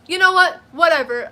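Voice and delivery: loud, high brush-off; dismissive retort. Retold or imitated. Retelling brushing off the clerk, loud and dismissive. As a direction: dismiss her loudly. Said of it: "my response to her was".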